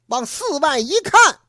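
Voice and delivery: usual speaking voice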